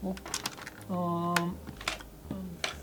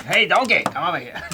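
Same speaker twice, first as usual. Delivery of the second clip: louder Scottish ogre call; Shrek voice; 4 dB louder than usual. Retold or imitated. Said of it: "he turns around like".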